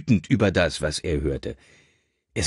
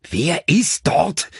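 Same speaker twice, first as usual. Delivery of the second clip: slow, higher-pitched insistent call; called-out demand; 9 semitones higher, 5 dB louder, much slower than usual. A narrator's reading. Richard demanding the caller's identity, calling out slowly. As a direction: call it out, drawing each word out. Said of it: "rief Richard wieder".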